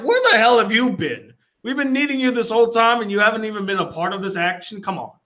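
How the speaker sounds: exasperated ranting at a latecomer; indignant outburst. Retold or imitated. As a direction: rattle off a mock scolding, high at first then easing. Recalling own outburst at Fury's absence.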